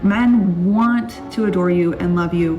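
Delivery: ordinary talk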